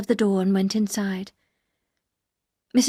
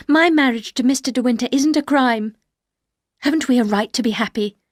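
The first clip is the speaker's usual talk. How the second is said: raised and indignant; wife defending her marriage, indignant; indignant defence; 4 semitones higher, 6 dB louder than usual. Acted. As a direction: stand up for yourself.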